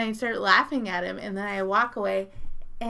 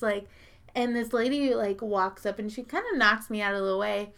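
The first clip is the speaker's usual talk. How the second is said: ordinary talk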